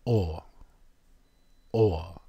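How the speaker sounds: soft, rounded "Or." said twice; sounding out. Shown as a demonstration. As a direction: hold "Or." as a rounded O vowel. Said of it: "sounds something like an O".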